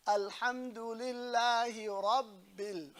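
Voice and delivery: slow "Alhamdulillahi Rabbil" with held l and b; deliberate "Alhamdulillahi Rabbil", doubled letters pressed; shadda on Lillah. Shown as a demonstration. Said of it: "has a shadda on Lillah".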